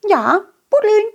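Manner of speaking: high whispery mole; small creature giving advice; little mole voice. Acted. Mole explains digging.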